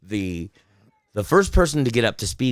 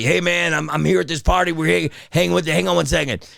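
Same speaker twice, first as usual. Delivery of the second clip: higher-pitched, chummy quote of a caller; retold upbeat buddy on the phone; party phone call; 7 semitones higher than usual. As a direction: check in from a lively party.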